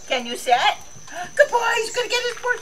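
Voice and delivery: high, fast pet talk. Real, not acted. Owner cooing at her dog, high sweet baby talk.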